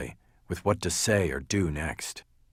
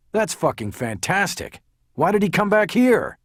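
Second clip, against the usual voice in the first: higher, agitated outburst; exasperated; 11 semitones higher, 6 dB louder than usual. A narrator's reading. Lawyer hears a hitter came for him, exasperated. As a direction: demand an answer, voice climbing.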